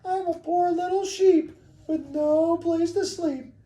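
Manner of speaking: very high, pleading falsetto lamb; sweet little voice. Acted. Wolf disguised as a lamb at the door, sweet little voice. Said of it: "in a sweet little voice".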